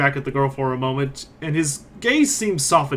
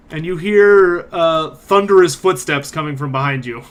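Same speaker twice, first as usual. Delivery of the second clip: usual speaking voice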